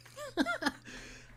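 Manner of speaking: high, squeaky burst of laughter; a helpless squeal of a laugh. Real, not acted. Laughing at the trailer.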